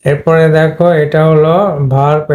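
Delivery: ordinary talk, nothing marked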